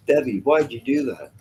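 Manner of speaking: usual speaking voice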